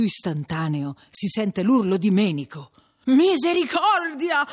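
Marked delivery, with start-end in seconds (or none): 3.1-4.5 high, loud, drawn-out cry; alarmed scream. A narrator's reading. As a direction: wail it out in alarm, stretching the word. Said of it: "grida anche Agnese".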